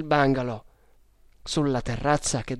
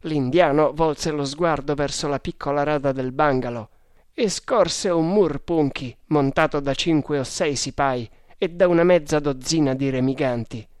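ordinary delivery, nothing marked

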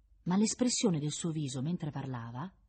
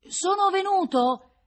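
high, slow, loud call; drawn-out shout through a grating; shouting over noise; 10 dB louder, slower than usual. A narrator's reading. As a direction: stretch the words out in a raised shout. Shouting over the visiting-room din.

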